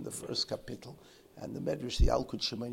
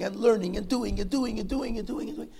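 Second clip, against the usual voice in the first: rising higher in a relentless repeated run; louder, higher, repetitive litany; endless piling-up; 9 semitones higher, 8 dB louder than usual. Shown as a demonstration. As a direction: pile them up as if they never stop. Piling up endless religious duties.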